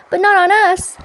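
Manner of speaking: high, loud horrified cry; oysters crying out. Acted. Oysters protesting to the walrus, high loud cry. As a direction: shriek it in protest at being eaten. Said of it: "the oysters cried".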